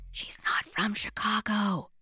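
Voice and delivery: playful conspiratorial whisper; hushed, teasing reveal of a secret. Acted. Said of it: "revealing a secret".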